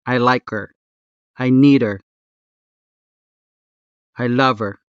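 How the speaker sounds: "her" without its H, run onto the verb; dropped H. Shown as a demonstration. Tutor compares with English for learners, dropped H in "her". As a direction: drop the H in "her", like "liker". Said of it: "like dropping the H in her".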